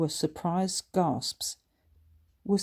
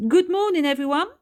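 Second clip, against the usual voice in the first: cheery teacher greeting; 12 semitones higher, 9 dB louder than usual. A narrator's reading.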